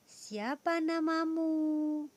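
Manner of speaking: high, slow questioning tune on "Siapa namamu?"; "Siapa namamu?" lifted high and drawn out; question intonation. Shown as a demonstration. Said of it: "Intonasi dalam kalimat tanya berbeda".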